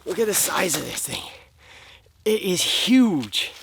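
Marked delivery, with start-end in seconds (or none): 2.2-3.5 awed. Real, not acted. Showing off the big fish.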